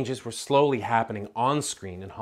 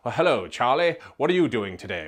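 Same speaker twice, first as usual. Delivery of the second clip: light mid-Atlantic accent, louder and crisply enunciated; mock mid-Atlantic accent; 5 dB louder, faster than usual. Shown as a demonstration. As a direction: play an old Hollywood star with a mid-Atlantic accent. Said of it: "both developed these kind of mid-Atlantic accents".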